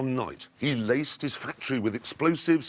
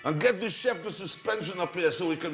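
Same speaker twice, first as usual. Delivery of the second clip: put-on French accent; 4 semitones higher than usual. Comedy sketch of French executives.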